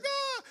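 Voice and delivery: high-pitched mock scream of frightened women. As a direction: shriek like terrified women in a tomb.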